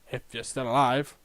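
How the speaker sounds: low, soft afterthought; muttered aside. Acted. Recruiter adding the catch, quiet aside. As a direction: drop right down and slip it in quietly.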